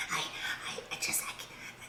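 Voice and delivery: breathy hushed whisper; whispering on the phone. Pretend. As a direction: keep it barely audible. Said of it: "I have to whisper".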